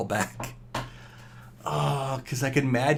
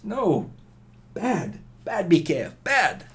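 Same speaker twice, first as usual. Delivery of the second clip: high, sharp mock scolding of himself; 7 semitones higher than usual. Gamer scolding himself for a risky attack. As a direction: scold yourself like a naughty pet.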